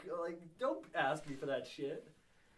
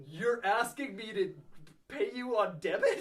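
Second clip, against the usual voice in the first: incredulous; 7 semitones higher, 7 dB louder, slower than usual. Retold or imitated. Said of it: "And I'm like".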